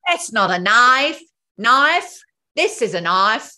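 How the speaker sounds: loud, high copied Australian accent. Shown as a demonstration. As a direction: slowly echo an Australian film line, loud and exaggerated. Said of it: "I just copied you".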